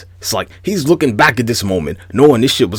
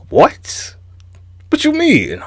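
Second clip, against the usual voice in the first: much higher, squeaky disbelief; incredulous; slower than usual. Real, not acted. Reacting to everyone giving Goku power, incredulous. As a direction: act stunned by the nonsense.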